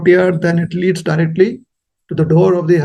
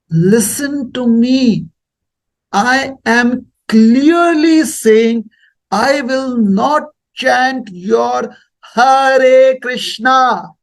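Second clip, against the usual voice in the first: very slow, higher, over-articulated; slow and deliberate; 4 semitones higher, much slower than usual. Retold or imitated. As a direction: spell it out for someone who can't understand. Speaking slowly to a foreigner.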